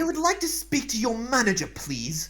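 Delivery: high, loud demand, dropping on the last word; complaining customer archetype. Acted. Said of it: "I can do, like, archetypes".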